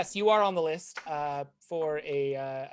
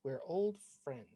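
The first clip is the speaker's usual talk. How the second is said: clerk voice; 5 semitones lower, 11 dB quieter than usual. Acted. DM voices shop clerk Natalie.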